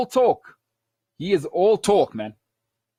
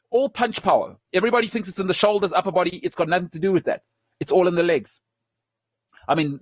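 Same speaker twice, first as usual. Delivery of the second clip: normal talking voice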